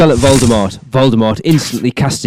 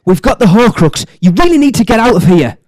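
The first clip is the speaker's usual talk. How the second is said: high, loud plea; urgent character voice; 11 semitones higher, 4 dB louder than usual. Acted. Horcrux thieves plead to get out of Gringotts, urgent cry.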